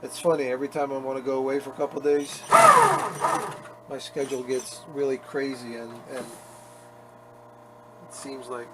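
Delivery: ordinary talk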